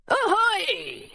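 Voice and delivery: high, drawn-out pirate hail; long falling call; pirate's cry. A narrator's reading. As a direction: hail the ship in a put-on pirate voice. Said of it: "he cried".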